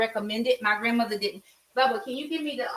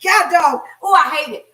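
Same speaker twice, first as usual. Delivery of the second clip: louder, higher frustrated burst; frustrated outburst; 4 semitones higher, 8 dB louder than usual. Retold or imitated. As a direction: blurt it out, fed up with the stutter. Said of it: "he stuttered so bad".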